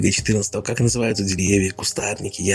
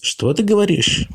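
surprised; 13 semitones higher, slower than usual. Acted. Bunnies surprised together.

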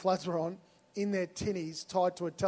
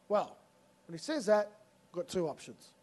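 ordinary talk, nothing marked

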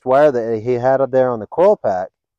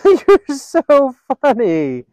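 giggly friend; 13 semitones higher, 5 dB louder, much slower than usual. Retold or imitated. Allison laughing at his remark.

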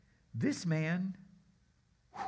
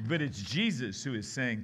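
usual speaking voice

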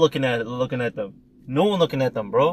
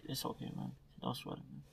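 soft reassuring dad; 3 semitones lower, 26 dB quieter than usual. Retold or imitated. Dad calming son, retold.

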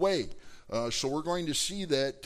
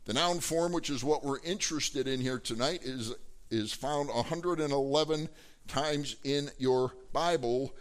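ordinary talk

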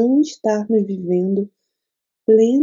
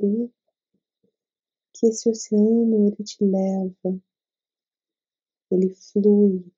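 guided meditation. Acted. Leads a meditation.

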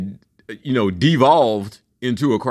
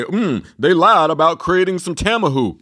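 imagined future reaction; 3 semitones higher than usual. Acted. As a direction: act out someone finding out the lie. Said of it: "look back later and say".

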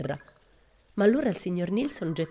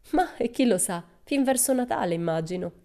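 Pippi offhand; 6 semitones higher, 3 dB louder than usual. Acted.

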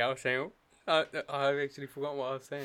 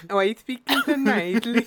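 high, loud put-on Scouse accent, through the nose; nasal Scouse voice; 10 semitones higher, 12 dB louder than usual. Friend teasing his mate on a call, in nasal Scouse voice. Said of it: "the Scouse accent come out".

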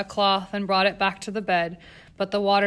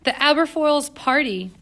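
reproachful; 6 semitones higher, 5 dB louder than usual. A narrator's reading. Governess scolding Jane.

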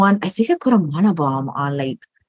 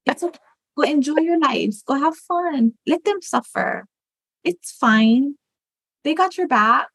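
higher, put-on coaxing voice; coaxing enabler voice; 6 semitones higher than usual. Inner enabler urging you to skip, higher coaxing voice. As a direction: sweetly talk them into skipping it. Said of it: "the other one be like".

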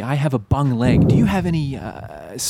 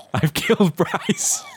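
laughing; 8 semitones higher, slower than usual. Acted. Laughs at killing patient.

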